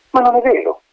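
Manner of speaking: put-on high, protesting child's cry; indignant cry. A narrator's reading. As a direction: shout back the unfair accusation. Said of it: "gridò Antonella".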